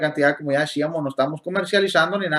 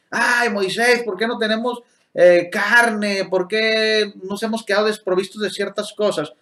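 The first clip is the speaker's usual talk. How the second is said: imitated grumbling crowd, pitched up at first; mock complaining; 4 semitones higher than usual. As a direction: complain to Moisés like the discontented people, hammed-up. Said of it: "se la pasaban quejándose". (imitando las quejas del pueblo)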